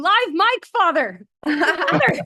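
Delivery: loud, high yell of warning. Retold or imitated. Yelling at the priest on the streamed Mass, loud and high. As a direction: shout a warning at the screen. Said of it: "a person who yells at the television".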